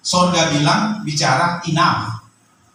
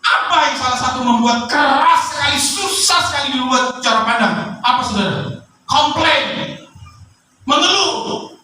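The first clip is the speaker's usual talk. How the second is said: raised, driving sermon delivery; preaching at full force; 13 semitones higher than usual. Acted. Pastor urging the church not to complain, preaching hard. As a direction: press the congregation hard against complaining.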